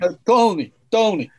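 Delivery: mock New York mafioso; put-on New York gangster voice; New York accent. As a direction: call Tony like a New York mobster. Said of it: "they just basically become, like, mafioso".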